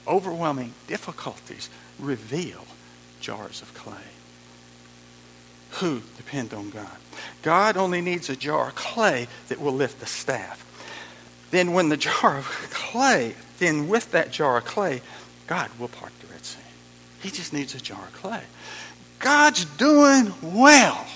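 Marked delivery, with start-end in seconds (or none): 0.1-7.0 quiet reread. Shown as a demonstration. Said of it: "I didn't read that incorrectly". 19.2-21.0 slow, loud, high mock praise; mock cheer. Preacher mock-cheering God's success.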